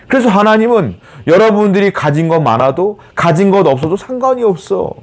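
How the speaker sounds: high-pitched, swooping pulpit exhortation; impassioned preaching. Real, not acted. Preacher assuring believers weak or strong, impassioned preaching. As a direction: drive the assurance home with rising fervour.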